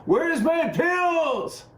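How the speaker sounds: put-on high, slow old-lady shriek; screaming old person. Old people scream at delivery drones, high slow shriek. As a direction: wail it up at the sky. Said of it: "old people screaming at the sky".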